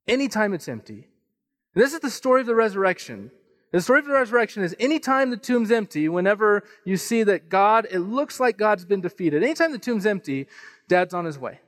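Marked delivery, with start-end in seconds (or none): 1.7-10.5 raised, quickening sermon delivery; preaching. Acted. Pastor drives home the resurrection message, preaching. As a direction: build the point to the congregation.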